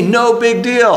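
"no big deal" tossed off high and sneering; mocking no big deal. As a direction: wave it away like a drunken scoffer. Babylonians mocking Israel's God.

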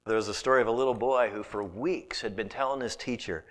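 normal talking voice

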